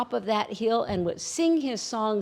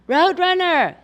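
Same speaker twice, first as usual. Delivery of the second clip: high, loud call from the hilltop; yelling down; 11 semitones higher, 11 dB louder than usual. Acted. Coyote yelling down the hill to Roadrunner. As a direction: shout her name down the hill. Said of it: "yelled down".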